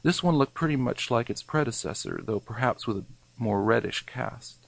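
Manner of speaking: usual speaking voice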